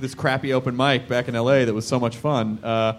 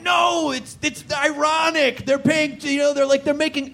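mock know-it-all friend; 10 semitones higher, slower than usual. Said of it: "He's like".

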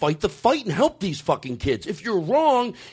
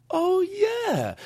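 high, drawn-out oh yeah; dawning realization; 12 semitones higher than usual. Acted. Comedian voicing middle America listening to the Pope, high dawning realization. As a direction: let the realization swoop up high.

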